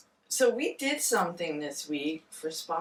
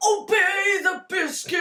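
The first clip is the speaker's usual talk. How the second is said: mock command; 12 semitones higher, 6 dB louder than usual. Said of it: "And I was like".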